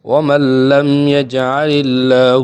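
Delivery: slow, loud melodic tajweed chanting; Quran recitation. Shown as a demonstration. Teacher recites the verse for students, slow melodic chant. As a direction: recite the verse in long drawn-out tones. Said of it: "تو اس کی میں نے تلاوت کر کے آپ کو سنا دی ہے".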